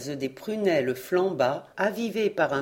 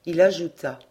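ordinary delivery

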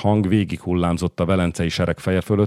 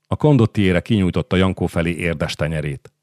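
ordinary delivery, nothing marked

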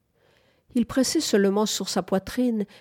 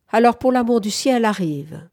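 loud, higher-pitched impatient urging; exasperated; 5 semitones higher, 6 dB louder than usual. A narrator's reading. A jealous woman calls her companion to bed, exasperated. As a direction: order them along, out of patience. Acted quarrel, impatient order to come.

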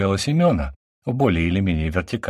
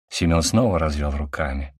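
ordinary delivery

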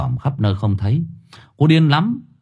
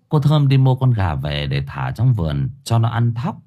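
ordinary delivery

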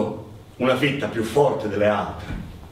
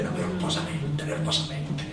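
much softer, slowed echo of nervosamente; nervous murmur; 4 semitones higher, 8 dB quieter than usual. A narrator's reading. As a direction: let nervosamente fade to a soft nervous echo. Rooster pacing nervously.

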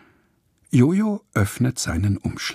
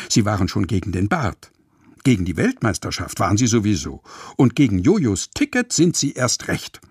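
ordinary delivery

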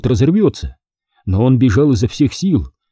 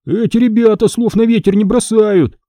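alarmed; 9 semitones higher than usual. A narrator's reading. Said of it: "встревожился Цыпа".

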